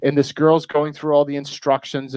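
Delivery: ordinary talk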